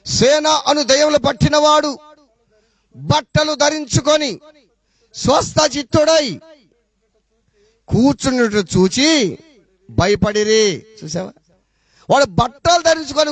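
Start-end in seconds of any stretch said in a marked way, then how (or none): 0.1-10.9 slow, raised reading voice with stretched phrase endings; declaimed Bible verse, pausing after each phrase; scripture reading. Acted. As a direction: read the verse out slowly, phrase by phrase. Reads a scripture verse aloud.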